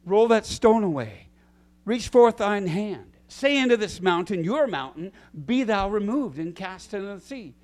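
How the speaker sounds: loud, ringing commands; commanding scripture. Acted. Preacher booming the Bible's commands to the church. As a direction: proclaim each command with authority.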